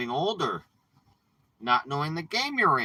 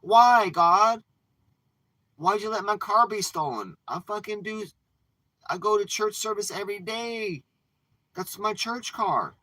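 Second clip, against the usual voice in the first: accusing God; 8 semitones higher than usual. Retold or imitated. A churchgoer wails at God over his stolen car. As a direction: wail up at God, wronged. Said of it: "Or you could be accusing God".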